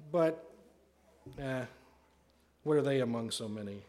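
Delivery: deflating doubt after a hesitant "eh"; skeptical. Acted. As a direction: start to offer it, then give up. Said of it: "too skeptical".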